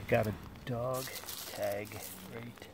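normal talking voice

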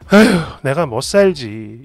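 low, slow, weary acted sigh; resigned sigh. Wife gives up arguing with husband, low resigned sigh. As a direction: sigh it out, giving up. 체념하는 혼잣말.

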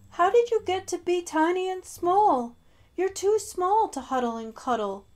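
high, loud sobbing complaint; a giant bear wailing; bawling bear. Acted. The giant bear bawls at his tiny teddy. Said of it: "the voice bawl".

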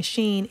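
Shein said as one syllable, "sheen"; wrong pronunciation. Retold or imitated. Correcting viewers who say it wrong, Shein pronounced "sheen". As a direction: run Shein together to rhyme with "green". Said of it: "it's wrong".

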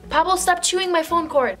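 high, loud scolding; scolding dog. Real, not acted. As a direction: tell the dog off sharply.

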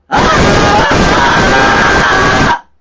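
a long, full shout of aah into the mic; loud test scream. Shown as a demonstration. Streamer testing whether shouting hurts ears, full shout.

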